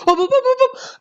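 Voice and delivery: rising high cries of excitement; excited. Real, not acted. Player watching the reels stop, rising excited cries. Reel landing on a win.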